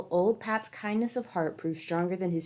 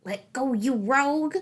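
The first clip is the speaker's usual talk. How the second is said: high angry leprechaun yell; furious little man shouting at a rabbit; 6 semitones higher, 4 dB louder than usual. A narrator's reading. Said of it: "the little man yelled down the hole".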